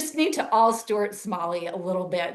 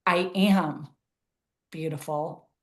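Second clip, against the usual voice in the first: "am" punched, with a pause after it; stress on AM; slower than usual. Shown as a demonstration. Showing an exercise of emphasizing different words, stress on "am". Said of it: "emphasizing different words".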